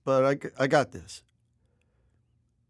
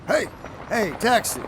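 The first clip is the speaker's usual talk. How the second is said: calling a taxi; 9 semitones higher than usual. Acted. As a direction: shout it across the street for a taxi. Hailing a cab on street.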